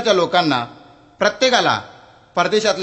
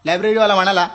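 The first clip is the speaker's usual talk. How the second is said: ordinary talk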